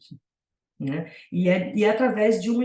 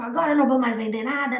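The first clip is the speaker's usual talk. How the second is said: quick, higher huffy retort; mock angry seller; 4 semitones higher, faster than usual. Imitating the annoyed seller backing out, quick and higher. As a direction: toss it off like an offended seller. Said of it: "ficou brava".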